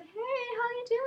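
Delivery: flirty Tammy.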